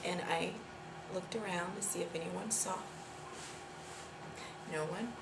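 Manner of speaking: soft, low, halting admission; subdued, guilty near-murmur; hushed confession. Acted. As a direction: confess it quietly, with long pauses.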